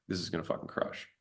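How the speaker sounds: hushed, gleeful whisper to himself; excited whisper. Retold or imitated. To himself after spreading flyers, an excited whisper. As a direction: whisper it like a secret win.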